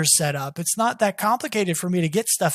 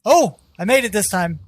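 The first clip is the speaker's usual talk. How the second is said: usual speaking voice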